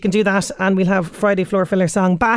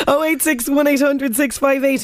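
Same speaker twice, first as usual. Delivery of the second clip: laughing; 4 semitones higher than usual. Real, not acted. Reading number after laughing.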